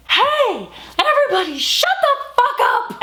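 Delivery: high yelled call to the crowd, slowed on the last words; retold high-pitched yell; mock shout. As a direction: shout to silence a packed party. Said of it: "and I said".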